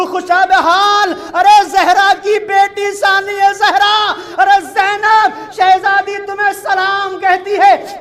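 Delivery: high, loud wailing majlis recitation; impassioned preaching. Acted. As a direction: cry it out to the gathering, voice lifted high. Majlis climax preaching at full force.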